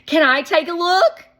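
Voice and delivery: put-on high, loud and fast kid excitement; excited little boy. A narrator's reading. Shake telling Shermie about the ice cream money, excited. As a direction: rush it out, thrilled.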